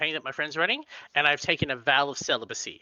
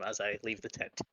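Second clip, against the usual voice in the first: ordinary talk, nothing marked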